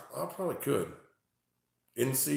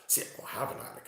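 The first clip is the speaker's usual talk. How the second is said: whispering; 9 dB quieter, much faster than usual. Real, not acted. Whisper while listening.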